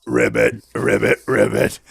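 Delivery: low frog croaks; low, short puppet-frog ribbits. Acted. Frog answers in the story.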